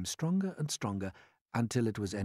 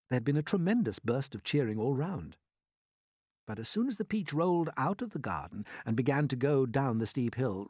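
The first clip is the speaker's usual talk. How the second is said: ordinary delivery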